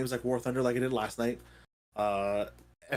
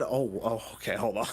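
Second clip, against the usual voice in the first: ordinary talk, nothing marked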